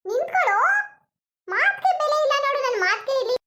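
high, loud scolding shout; mother-in-law yelling at her son to stop; yelling after him. Acted. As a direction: shout at the son walking away.

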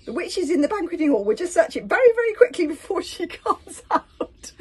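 high, loud urgent voice; urgent pleading. A narrator's reading. Friend urged to search before the witch returns, urgent. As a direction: press the point at a high pitch. Hiccup urges quick search of hut.

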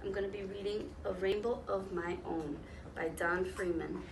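ordinary delivery

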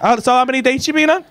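normal talking voice

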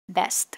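"Best" ending with a clear s then t; ending sound demo. Shown as a demonstration. Teacher models the final st of "Best". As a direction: finish "Best" on both s and t. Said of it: "âm S và âm T ở cuối".

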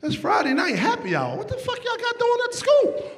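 high-pitched disbelieving complaint; incredulous outrage. Retold or imitated. Dad fuming about school activities, high incredulous whine. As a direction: squeal it out in disbelief.

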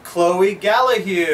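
slow, loud name call; announcing winner. Real, not acted. Drawing a prize winner's name.

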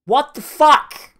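high, slow, loud disbelief; stunned what the fuck. Real, not acted. Player reacting to the boss suddenly hitting harder, stunned and loud. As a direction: drag it out in shock at the game.

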